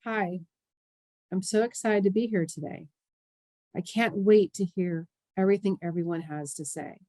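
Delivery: monotone, low-key mock enthusiasm; deadpan greeting, drained of inflection; unexcited delivery. Shown as a demonstration. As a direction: say excited words with no excitement at all. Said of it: "That's not what you're here to see".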